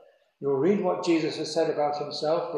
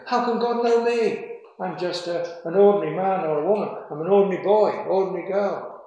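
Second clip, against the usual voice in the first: higher, louder incredulous objector; doubting listener; 5 semitones higher, 6 dB louder than usual. Retold or imitated. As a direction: voice the listener's disbelief, pitched up. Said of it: "Oh, but you might say".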